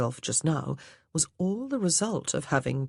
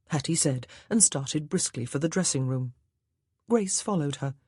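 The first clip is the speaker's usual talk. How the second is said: plain reading voice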